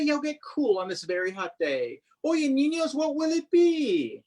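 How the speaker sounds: ordinary delivery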